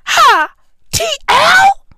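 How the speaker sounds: very high, loud shriek; hammed-up, high-pitched incredulous cry; outraged disbelief. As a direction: crack into a squeal of mock outrage. Comic outrage at the abbreviation.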